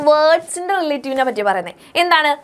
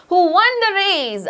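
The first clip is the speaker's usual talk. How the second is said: very slow, higher, leaning on who; slow stressed clause; 6 semitones higher, much slower than usual. Shown as a demonstration. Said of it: "അവന്റെ പ്രത്യേകത എന്താണ്".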